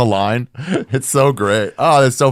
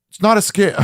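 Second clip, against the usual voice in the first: high-pitched, louder retold protest; indignant denial; 11 semitones higher, 4 dB louder than usual. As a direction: insist on it, voice pitched way up. Scammed man denies it, retold.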